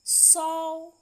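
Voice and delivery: सौ loud, with a held au; long au. Shown as a demonstration. Teacher modelling au for learners, strong long au in सौ. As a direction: push and lengthen the vowel of सौ. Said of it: "अधिक समय लगता है".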